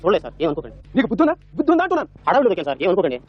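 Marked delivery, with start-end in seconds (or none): 1.0-2.1 high, sharp scolding question; indignant scolding. Acted. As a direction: demand it like an offended elder. Scolding the man who stepped. (కోపంగా తిట్టడం)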